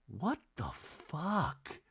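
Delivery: slow, drawn-out, softened swearing; disbelief. Real, not acted. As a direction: stretch each word in stunned disbelief. Host's own disbelief.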